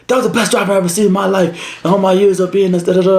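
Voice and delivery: high, quick put-on voice gushing praise; rapid, high-pitched mock amazement; amazed trooper imitated.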